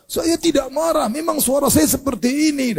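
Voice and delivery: high, drawn-out angry protest. Acted. Angry man denying he is angry, high indignant voice. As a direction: act out indignant denial of being angry. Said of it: "kalau berbicara angkat suara".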